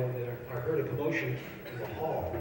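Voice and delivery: normal talking voice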